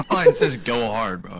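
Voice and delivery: mock insistence. Egging a reluctant friend on, loud mock insistence. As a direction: burst out insisting, playfully.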